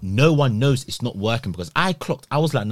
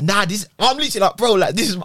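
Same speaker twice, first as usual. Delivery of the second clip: high, louder laughing protest; laughing disbelief; 10 semitones higher, 4 dB louder, faster than usual. Retold or imitated. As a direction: laugh through the words in disbelief. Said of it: "I'm laughing 'cause I'm still".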